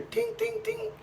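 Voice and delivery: high, soft pinging ting; imitated ringing. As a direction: mimic the ringing in your ears. Ringing in head after blow.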